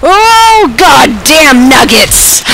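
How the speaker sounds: drawn-out, high-pitched loud wail of frustration; exasperated outburst. Real, not acted. Gamer cries out at a failed jump, exasperated outburst.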